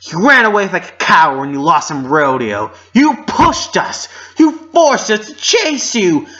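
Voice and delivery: high, loud, furious yelling; furious shout. Acted. Twilight screaming at Applejack in fury. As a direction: scream the accusation at her.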